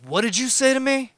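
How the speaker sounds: mock-challenge him, pitched well up; retold confrontational challenge. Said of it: "he was trying to step to me".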